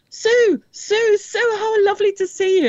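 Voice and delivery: high, delighted sing-song greeting; gushing greeting. Retold or imitated. Astronaut spotting Sue in the crowd, gushing high greeting. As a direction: gush over an old friend, pitched high.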